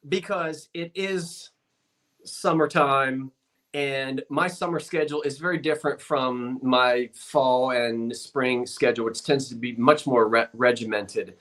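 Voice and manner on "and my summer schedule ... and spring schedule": normal talking voice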